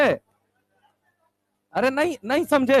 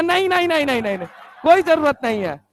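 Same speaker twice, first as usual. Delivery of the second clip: no no no through laughter; teacher laughing off the student's offer; laughing refusal; 4 semitones higher than usual. Retold or imitated. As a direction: laugh it off quickly.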